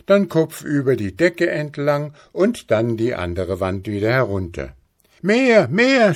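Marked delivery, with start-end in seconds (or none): 5.2-6.1 put-on high, loud childish demand; schreiend. A narrator's reading. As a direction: cry out greedily, pitched way up. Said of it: "schrie Häwelmann". (hoch und laut fordernd geschrien)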